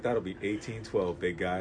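low, soft and slow radio-host voice; smooth jazz host. Retold or imitated. Drive-thru worker gives the total like a smooth jazz host. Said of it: "sound like a smooth jazz host".

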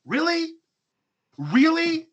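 high, loud disbelieving question; mock incredulous. Host scolding complaining gold medalist athletes, mock incredulous.

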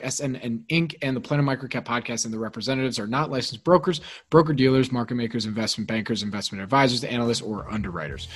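quiet, quick disclaimer read; fast legal disclaimer. Acted. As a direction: rattle off the fine print softly. Legal disclaimer read under music.

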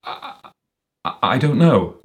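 hesitant, stammering admission; muttered stammer. A narrator's reading. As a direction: trip over the first word, then blurt it. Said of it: "muttered Walter".

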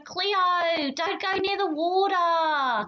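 high, slow, drawn-out warning cry; hammed-up TV mermaid calling out, stretched vowels; imitated show characters' call. Imitating H2O characters warning Cleo, exaggerated high call. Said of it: "just like that".

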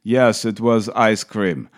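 slow, imitated Austrian accent; Arnold accent. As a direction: answer slowly in a mock Austrian accent. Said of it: "And then Arnold answers".

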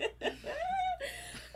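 a high squeal of laughter, almost crying; high laughing squeal. Real, not acted. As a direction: lose it laughing. Laughing at friend's joke.

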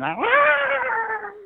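high, loud shriek; creature shriek. Acted. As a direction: shriek as it flees.

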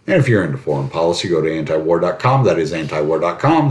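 rapid-fire ad read; talking fast. Real, not acted. Host racing through a foreign policy website plug, talking fast. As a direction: rattle it off at auction speed. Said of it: "why I'm talking this fast".

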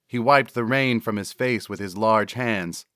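ordinary delivery, nothing marked

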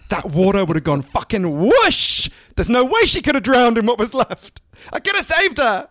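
hammed-up indignation, climbing to a cry; mock outrage. Acted punchline of comedian's mock grief.

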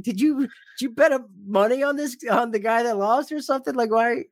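high, puzzled mock girlfriend voice; girlfriend's confused voice. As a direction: play the bewildered girlfriend asking. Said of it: "She's like".

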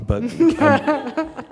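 high laugh breaks into words; giggling at own blunder. Real, not acted.

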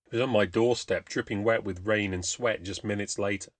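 ordinary delivery

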